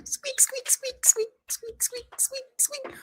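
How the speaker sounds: tiny squeaking mouse, very high and soft; mouse squeaks. Pretend. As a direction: squeak like a little mouse in a falsetto. Mouse noises for cat donors.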